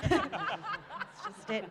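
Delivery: high, slow, laughing farewell; laughing with joy. Real, not acted. Actress laughing as she bids the world good night. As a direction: laugh through the words in delight. Overjoyed by Cate's praise.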